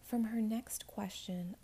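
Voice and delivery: ordinary delivery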